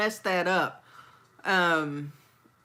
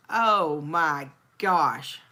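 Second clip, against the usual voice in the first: normal talking voice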